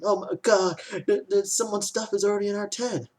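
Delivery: high-pitched put-on whine of complaint; exaggerated high-voiced complainer; mock complaining. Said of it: "you have people complaining".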